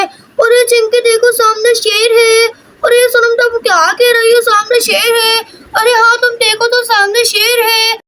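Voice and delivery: put-on high-pitched storybook child voice; alarmed little girls. Girls see the lion. (घबराई बच्ची की ऊँची आवाज़)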